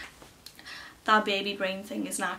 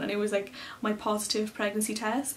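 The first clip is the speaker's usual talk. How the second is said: usual speaking voice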